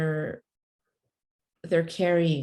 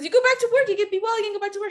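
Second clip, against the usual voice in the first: very high, hammed-up doctor voice; over-excited doctor; 3 dB louder, much faster than usual. Doctor repeats to patient she can work, hammed-up excitement. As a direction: squeal the good news as an imitated doctor. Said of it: "he goes so excited".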